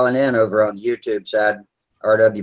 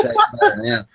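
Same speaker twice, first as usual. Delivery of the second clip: laughing it out, pitched up high; words squeezed through a real laugh; giggling while teasing a friend about his beer. Real, not acted.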